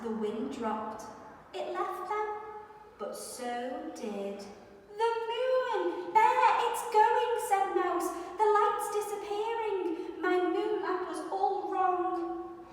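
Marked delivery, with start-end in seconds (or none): 5.0-12.6 high, louder alarmed mouse voice; distressed mouse. Acted. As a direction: cry out as the light fades. Mouse sees moon go.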